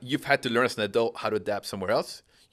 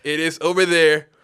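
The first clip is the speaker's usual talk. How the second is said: much higher and louder, a mock proud announcement; hammed-up immigrant parent; 8 semitones higher, 10 dB louder than usual. Immigrant parent announcing to the family they are leaving for the mission field, in a mock loud high voice. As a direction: play the parent declaring the big move with over-the-top zeal. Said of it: "turns around and says".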